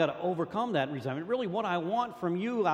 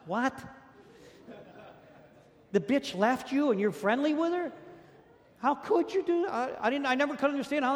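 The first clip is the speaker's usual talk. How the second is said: rising higher and higher in scornful disbelief; can't fathom it, push each question up; outraged disbelief; 9 semitones higher than usual. Real, not acted. Scoffing at exes who stay friends, climbing in outraged disbelief.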